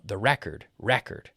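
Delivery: RECORD as REC-ord, the noun; RECORD with REC stressed; stress on first syllable. Shown as a demonstration. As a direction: hit the first half of RECORD. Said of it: "So as a noun".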